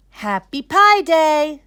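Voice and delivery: high, loud, slow cheer; class cheer. A narrator's reading. Class cheers for Kevin's Pi Day, loud. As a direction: shout it out as a class.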